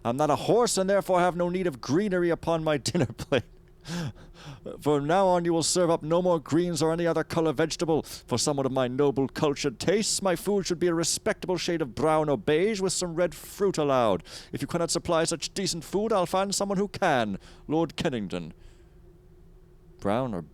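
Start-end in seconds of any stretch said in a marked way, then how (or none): 0.0-10.2 high, loud haughty aristocrat; pompous noble. Acted. Reading a haughty noble's letter. 10.2-13.8 high, loud haughty aristocrat; pompous noble. Acted. Reading a haughty noble's letter. 13.8-18.5 high, loud haughty aristocrat; pompous noble. Acted. Reading a haughty noble's letter.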